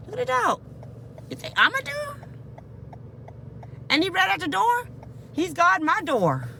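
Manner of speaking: shocked at the dog at the door; alarmed disbelief. Real, not acted.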